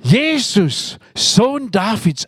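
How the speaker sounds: mild acted shout; mock cry out. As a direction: call out to Jesus from the roadside. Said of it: "da schreit er".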